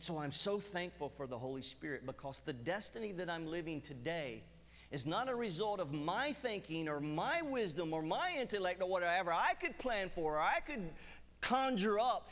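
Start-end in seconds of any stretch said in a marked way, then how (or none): 4.9-12.3 rising, louder preaching; preaching at full force. Acted. Preacher proclaiming to the congregation, building louder.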